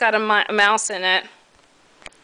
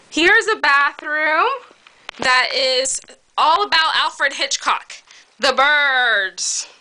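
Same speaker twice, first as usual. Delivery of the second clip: ordinary talk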